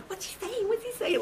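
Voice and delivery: high, hushed repeated question; imitated small, high, eager voice; urgent aside. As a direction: pester softly from beside the phone. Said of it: "Like that, you know?".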